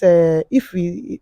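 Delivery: ordinary delivery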